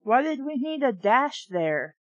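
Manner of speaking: usual speaking voice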